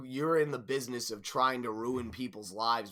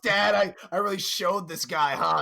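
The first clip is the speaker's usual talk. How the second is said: mock kid to dad; 5 semitones higher, 6 dB louder than usual. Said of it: "You're like".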